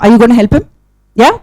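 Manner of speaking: usual speaking voice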